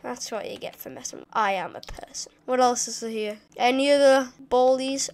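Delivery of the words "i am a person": usual speaking voice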